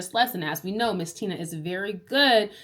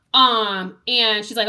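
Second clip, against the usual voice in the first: ordinary talk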